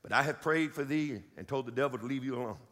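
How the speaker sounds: a planted mistake to catch the listeners; a low, mock-confident wrong reading; deliberate misquote. Shown as a demonstration. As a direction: read it slightly wrong on purpose, low and steady. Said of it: "I'm gonna do this on purpose".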